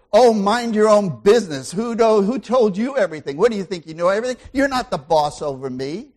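loud indignant comeback, rushing; defensive retort. Pretend. Tempted husband snapping at his wife, loud indignant retort. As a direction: snap back like you've been insulted. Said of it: "you get tempted and say".

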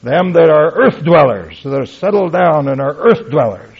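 martyrs crying out loud to God. Retold or imitated. Preacher voicing the slain souls' loud plea to God, loud and high. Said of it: "They cried with a loud voice".

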